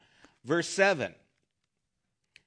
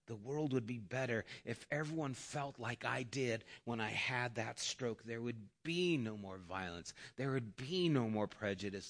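soft reflection; 8 semitones lower, 12 dB quieter than usual. Retold or imitated. Quoting the scientist's reflection.

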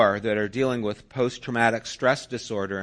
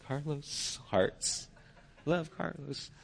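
soft, higher, breathy-sounding lovestruck murmur; dreamy daughter; 4 semitones higher, 8 dB quieter, slower than usual. Retold or imitated. Daughter doodling her crush's name, softly lovestruck. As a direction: coo the name like a smitten girl.

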